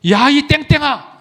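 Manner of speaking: high, slow mock yell at a friend; retold insult shout. Cave dweller mocks his freed friend with a slow, high insult. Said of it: "욕을 써요".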